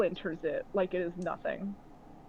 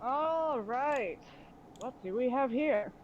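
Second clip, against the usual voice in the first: lightly voiced pirate leader sizing up captives; a hint of a swaggering captain; slower than usual. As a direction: draw out the opening, looking them over. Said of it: "and is like".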